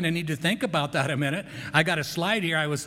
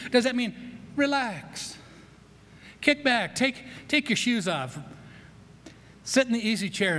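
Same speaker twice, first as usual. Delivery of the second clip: mock lazy relaxing; 7 semitones higher than usual. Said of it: "mocks relaxing".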